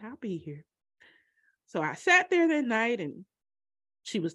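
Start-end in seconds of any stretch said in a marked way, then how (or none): 0.0-0.6 faint. Retold or imitated. Mother's faint answer.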